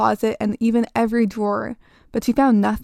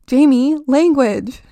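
loud, higher sharp reprimand; mom rebuking; 4 semitones higher, 8 dB louder, slower than usual. Acted. Mother scolding her daughter's language, loud and sharp. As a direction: call her out on her swearing.

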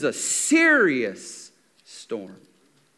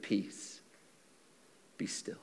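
very soft, low near-whisper; hushed command; 13 semitones lower, 20 dB quieter than usual. Retold or imitated. Jesus speaking to the storm, hushed and calm. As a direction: still the storm with barely any voice.

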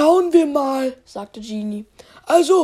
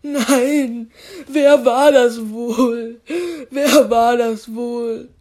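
slow, low, tearful wail; crying; 4 semitones lower, much slower than usual. Acted. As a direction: whimper it out like a sulking kid. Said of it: "Spike lag weinend in seinem Bett".

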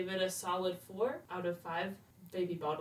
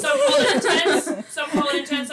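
laughing; 8 semitones higher, 12 dB louder than usual. Acted. Bachelor bragging.

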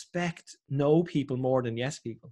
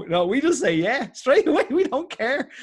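laughing; 10 semitones higher, 6 dB louder than usual. Real, not acted. Joking about Irish saying yes.

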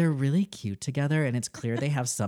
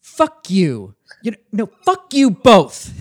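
furious higher shout, much louder; Melissa; 7 semitones higher, 12 dB louder, slower than usual. Acted. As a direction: scream it at them. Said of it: "you're gonna play the role of Nick".

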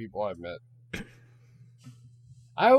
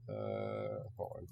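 under-breath reading; 18 dB quieter, much slower than usual. Real, not acted. Reading sponsor name under breath.

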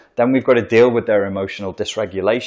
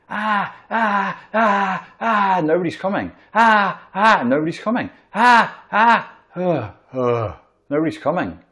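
put-on high, whimpering cries of a lost baby; mock whimpering baby; 9 semitones higher than usual. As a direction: cry out helplessly like an abandoned child.

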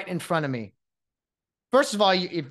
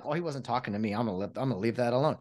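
low, soft mock indifference; casual, quieter, letting it go; unbothered mumble; 6 semitones lower, 5 dB quieter, much faster than usual. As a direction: shrug it off under your breath. Acting out an unbothered family member.

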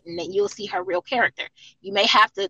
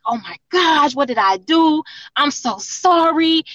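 a touch of tearful panic, pitched up and a bit louder; mock the boyfriend's sobbing sorry, half-acted; imitating his crying apology; 5 semitones higher, 5 dB louder, slower than usual. Retelling her ex's tearful apology after pushing her, mild mock crying. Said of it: "He started crying".

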